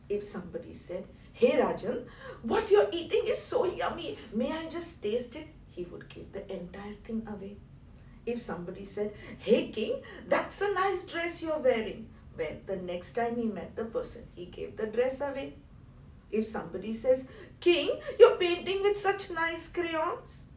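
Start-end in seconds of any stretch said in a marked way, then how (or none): 1.3-5.5 high, loud, excited request; eager admirer. Acted. Someone admires and begs the king's food. 9.4-12.1 high, excited compliment; bright-eyed admirer, pitched up; admiring gush. Acted. Someone admires the king's dress. 17.6-20.4 high, loud, drawn-out compliment; slow, excited gushing; admiring gush. Acted. Someone admires the king's crayons.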